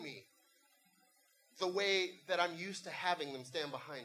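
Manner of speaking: soft, hushed sermon voice; reflective preaching. Acted. Pastor turning to spiritual crises, hushed intimate preaching. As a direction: drop down and speak intimately to the congregation.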